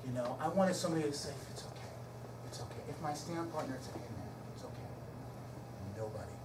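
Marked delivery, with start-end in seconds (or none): 1.5-2.8 gentle reassurance. Retold or imitated. Wished-for comforting words. 3.7-4.9 soft, low hushed reassurance; barely voiced consolation; hushed comfort. Partner's imagined comfort.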